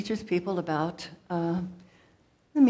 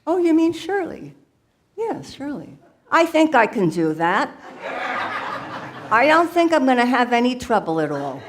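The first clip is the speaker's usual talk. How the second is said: louder, higher, chirpy mother voice; her mama Shirley; 5 semitones higher, 5 dB louder than usual. Acted. The alter ego becomes her mama Shirley, bubbly and loud. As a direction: play her own mom, bubbly and loud. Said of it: "How about my mama?".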